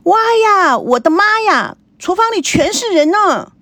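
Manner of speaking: put-on young girl's high excited shout. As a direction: burst out yelling in surprise. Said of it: "大叫了跑出来".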